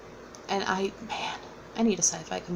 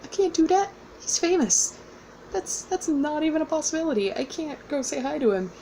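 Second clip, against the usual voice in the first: high, flustered protest; mock panic, voice jumping up; panicked fangirl; 8 semitones higher than usual. Refusing to approach a famous author, high flustered mock panic. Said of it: "And I went".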